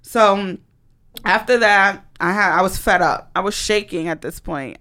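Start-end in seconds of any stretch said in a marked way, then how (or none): none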